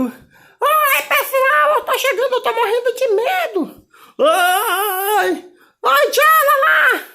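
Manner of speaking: very high, giggly doll voice, nervous and excited; Barbie shrieking hello while laughing. Acted. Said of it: "A Barbie tá chegando!". (Barbie com voz aguda e risonha)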